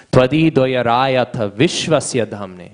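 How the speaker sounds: slow, measured Sanskrit recitation; verse recitation. Real, not acted. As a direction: recite the verse slowly, stretching the syllables.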